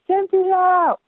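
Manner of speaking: high, drawn-out name call; retold excited greeting. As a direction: imitate a thrilled caller greeting her. Imitating caller's delighted greeting.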